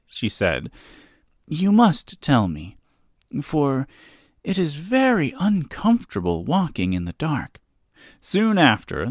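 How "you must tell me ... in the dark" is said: lightly voiced girl, higher pitch; a hint of a little girl asking; young girl voice. Dorothy voiced by narrator.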